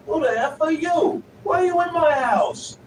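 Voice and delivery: loud, high furious yelling at an intruder; angry yell. Retold or imitated. A stranger's dad yelling at a kid in his house, furious shout. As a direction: bellow it like an enraged Jersey dad. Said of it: "getting yelled at by discount Tony Soprano".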